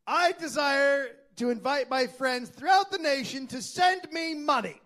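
high, loud proclaiming Charles. Retold or imitated. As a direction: address the courtroom grandly.

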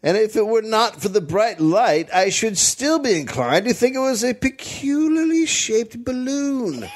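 hammed-up old-time witness; old-timey witness. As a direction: read the quote like an old-time eyewitness.

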